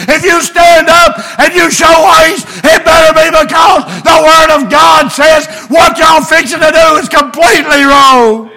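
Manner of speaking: high, loud shouted preaching; preacher hollering the warning to the congregation; preaching at full force. Real, not acted. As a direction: drive the sermon home with fire.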